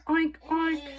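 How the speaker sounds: high, loud happy oinking; pig oinks. A narrator's reading. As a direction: oink like a gleeful pig. Pig Mercy oinks happily.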